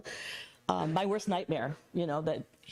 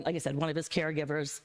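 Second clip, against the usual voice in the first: ordinary talk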